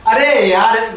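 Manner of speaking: fed-up friend exclaiming; exasperated. Real, not acted. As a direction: throw up your hands at the friend.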